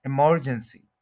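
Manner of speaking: Emergency as i-MER-jen-si, MER stretched; slow emergency with a heavy MER; stress on second syllable. Shown as a demonstration. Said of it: "second syllable पे stress है".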